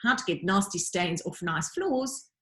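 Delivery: ordinary delivery, nothing marked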